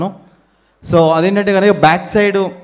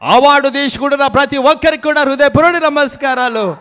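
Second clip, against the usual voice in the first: mimicked excited politician; 6 semitones higher than usual. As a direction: shout the news that the leader has come. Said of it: "play for mimicry video".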